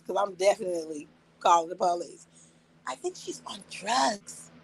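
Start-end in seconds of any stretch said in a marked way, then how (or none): 2.9-4.5 whispered aside to the officer; sly whisper. Retold or imitated. Confiding to the police about the attacker, whispered. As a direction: lean in and whisper it like a secret.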